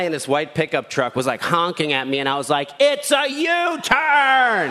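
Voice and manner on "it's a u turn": high, drawn-out mock yell. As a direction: call it out high and slow, defensive. Yelling at honking driver.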